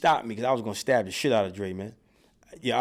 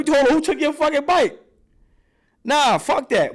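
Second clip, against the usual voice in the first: much higher, louder outrage; indignant shout; 13 semitones higher, 6 dB louder than usual. Retold or imitated. Big brother shouting about who took the bike, much higher and louder. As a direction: explode with disbelief. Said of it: "I'm like".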